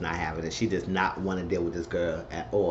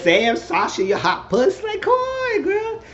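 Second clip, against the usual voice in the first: very high, loud teasing squeal; high, loud cheer for Sasha; squealing hype; 6 dB louder, slower than usual. Real, not acted.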